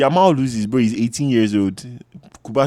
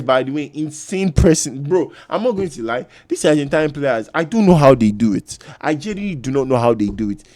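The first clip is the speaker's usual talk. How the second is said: usual speaking voice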